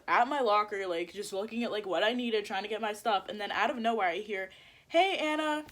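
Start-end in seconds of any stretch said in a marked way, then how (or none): 4.9-5.6 high-pitched, sing-out hello; mimicked friend calling out; imitated greeting call. Retold friend calls out to her.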